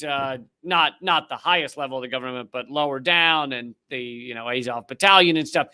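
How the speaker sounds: ordinary talk, nothing marked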